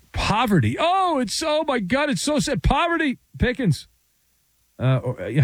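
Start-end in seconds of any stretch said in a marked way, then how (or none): 0.1-3.9 high, hammed-up fake sobbing lament; mock the fans' fake grief, pitched way up; mock crying. Mimicking fans crying poverty over the franchise tag, hammed-up wail. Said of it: "many people crying".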